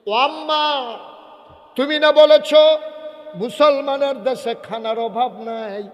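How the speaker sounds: high, loud acted wail; loud mock-crying plea, high-pitched; child's crying protest. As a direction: wail it out as a hungry child to mother. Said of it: "বাচ্চা কান্দে আর কয়".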